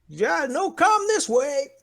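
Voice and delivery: put-on high, slow Polish-accented call; broken English. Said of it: "He speaks some broken English".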